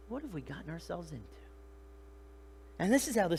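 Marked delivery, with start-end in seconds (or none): 0.1-1.2 soft, quick, stunned question; acted hushed amazement; awed disbelief. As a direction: mutter it in dawning alarm. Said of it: "fear is like awe and disbelief".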